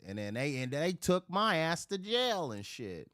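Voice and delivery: ordinary talk, nothing marked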